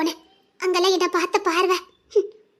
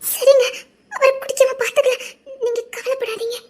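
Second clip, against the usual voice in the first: tearful comfort; 5 semitones higher than usual. Acted. Wife comforts crying husband through tears.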